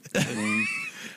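high squealing laugh. Real, not acted. Burst of laughter.

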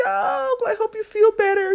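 high, cooing get-well voice; sympathetic. Retold or imitated. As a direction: comfort a sick friend sweetly. Sympathetic reply to sick colleague.

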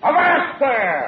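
drawn-out sailor's shout; shouted order. Acted. Sailor objects as the door is locked, shouted order. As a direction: holler a halt like a bosun.

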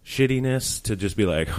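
ordinary delivery

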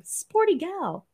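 "Sporty gal" drawn out slowly and high, mocking her own phrase. Shown as a demonstration. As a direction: repeat "Sporty gal" slowly as if it's cringe. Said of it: "that sounds super lame".

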